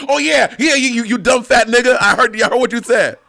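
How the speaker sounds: high, loud mocking imitation of a gloating friend; mocking impression. Mimicking his co-host catching a slip, high and loud mockery. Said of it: "That be you when I misspeak".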